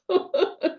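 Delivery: high bright burst of laughing at herself; real laugh breaking out; burst of laughter. Real, not acted. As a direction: laugh out at the memory. Laughing at own money panic.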